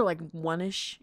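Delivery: normal talking voice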